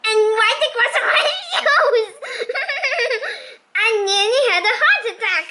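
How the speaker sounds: high laughing, words rushed out. Real, not acted. Reader laughing as the spider runs over shoes, laughing. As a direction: laugh through it, then blurt fast.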